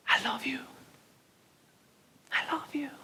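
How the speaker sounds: high unvoiced whisper. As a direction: breathe it like a voice in the mind. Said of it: "sentía el susurro". (susurro agudo)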